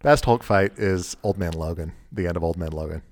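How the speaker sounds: ordinary talk, nothing marked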